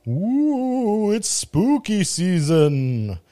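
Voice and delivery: ghostly, drawn-out spooky tone. Acted. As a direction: play up the creepy vibe. Spooky season intro.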